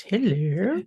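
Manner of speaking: prim rising phone hello; telephone voice. Pretend. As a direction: greet like picking up the phone. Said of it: "this telephone voice".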